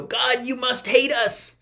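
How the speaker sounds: a lightly hammed-up hurt cry to God; whiny complaint to God. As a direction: act out a sulking believer. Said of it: "we go".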